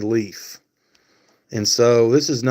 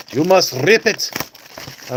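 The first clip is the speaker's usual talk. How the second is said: higher-pitched mock Russian accent; 4 semitones higher than usual. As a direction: do a playful, put-on Russian accent. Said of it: "Russian accent".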